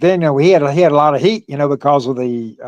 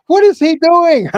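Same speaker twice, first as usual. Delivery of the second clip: much higher, slow mock screaming; a retold scream, pitched way up and drawn out; mock yell; 12 semitones higher, 4 dB louder than usual. Agent shouting at the wrestler on the mic, mock screaming. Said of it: "He's screaming".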